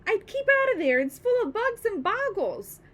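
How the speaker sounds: put-on a loud boy's warning call. A narrator's reading. George warning Grandma, calling upward. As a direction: call up to her in alarm. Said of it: "George called out".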